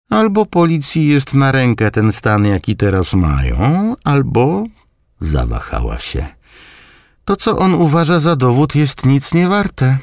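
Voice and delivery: high grandmother's voice, hesitating midway; grandma voice. A narrator's reading. Grandmother reasons with her grandson about evidence, voiced high.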